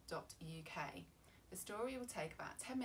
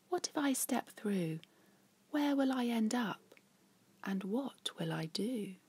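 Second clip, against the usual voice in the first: curious wondering; 4 semitones higher, 11 dB louder, slower than usual. Acted. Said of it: "Cyril wonders".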